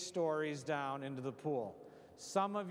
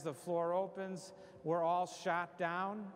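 normal talking voice

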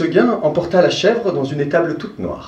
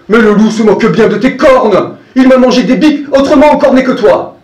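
higher, louder stammering warning; frightened for her, warn urgently; alarmed old farmer; 7 semitones higher, 5 dB louder than usual. Acted. Seguin warning his goat about the wolf, loud and alarmed.

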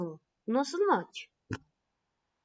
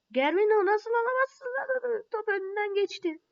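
much higher, loud outraged cry; high-pitched disbelieving shout at the screen; exasperated yell; 10 semitones higher, 3 dB louder than usual. Real, not acted. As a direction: scream at the player for missing it. Scolding a player's miss.

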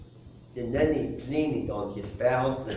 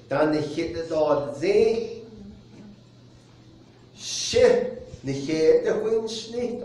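raised, high exhortation to the congregation; impassioned preaching; 6 semitones higher, 6 dB louder than usual. Real, not acted. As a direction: press it home from the pulpit, voice lifted. Exhorts congregation in two languages.

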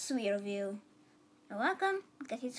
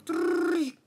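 single high mouth-made beep; imitated phone beep; 5 semitones higher, 4 dB louder than usual. Waiting on a pretend phone call, high imitated beep.

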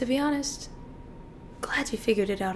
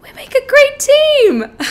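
high, loud, drawn-out crowing; exultant shout; 11 semitones higher, 9 dB louder, slower than usual. Acted. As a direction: crow it triumphantly, slowly and at full voice. Exults to the horrified lawyer.

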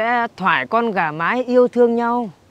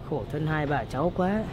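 pitying murmur; 4 semitones lower, 10 dB quieter than usual. Acted. Neighbour pities grandmother and child.

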